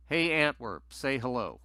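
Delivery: ordinary delivery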